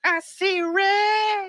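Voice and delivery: very high, drawn-out mock squeal; put-on squeal. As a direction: stretch it out slowly in a high, silly voice. Echoes phrase in put-on voice.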